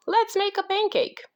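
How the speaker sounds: high storybook call; mild shout in a high voice. A narrator's reading. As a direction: pitch it up as Jack calling out. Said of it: "shouted Jack".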